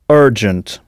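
"Urgent" as er-jent, weight on the first syllable; English pronunciation. Shown as a demonstration. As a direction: model "Urgent" slowly for learners. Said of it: "notez bien la prononciation".

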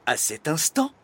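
ordinary delivery, nothing marked

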